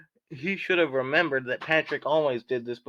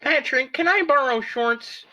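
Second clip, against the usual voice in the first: SpongeBob voice; 10 semitones higher, 4 dB louder than usual. Acted. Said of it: "SpongeBob asked".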